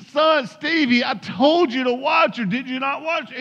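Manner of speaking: high-pitched parental reproach; exasperated scolding. Acted. Father to Stevie after his sister fell, scolding. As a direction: scold a child in acted disbelief.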